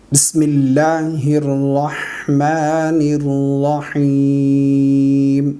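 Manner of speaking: long drawn-out chanted recitation; slow Quran recitation. Shown as a demonstration. Teacher recites the surah for students, slow and chanted. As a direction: recite slowly, stretching every vowel.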